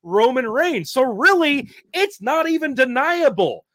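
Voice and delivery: loud, high, fired-up promo; wrestling promo. Acted. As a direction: cut it like a heel on the mic. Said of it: "that's how you cut a promo".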